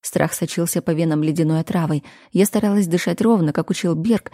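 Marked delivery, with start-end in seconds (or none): none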